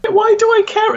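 high, incredulous outburst; why-would-anyone-care squeal; exasperated. Real, not acted. Mock exasperation at Wikipedia detail.

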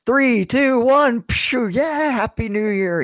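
high-pitched New Year's cheer; party countdown. Acted. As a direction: count down and whoop like a reveler.

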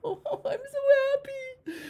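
high, choked crying on the words; tearful, overwhelmed happy sob; happy tears. Retold or imitated. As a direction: cry with joy as you say it. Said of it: "I just felt like".

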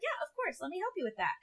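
high-pitched helpful tone; customer service voice. Shown as a demonstration. Helping a customer at work, high service voice. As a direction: pitch it up to sound helpful and professional. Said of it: "customer service voice is a little bit higher".

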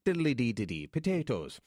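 imitating a comic's Irish accent; mock Irish accent. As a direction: echo the joke in mock Irish. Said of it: "This would be an Irish person".

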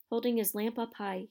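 ordinary delivery, nothing marked

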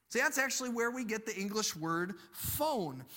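ordinary talk, nothing marked

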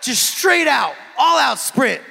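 loud, high, excited burst; excited shout. Real, not acted. Preacher shouting to the congregation about an all-out sprint, excited.

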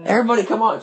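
high, excited party shout. Retold or imitated. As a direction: call it out to the whole patio. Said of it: "shouts to everybody".

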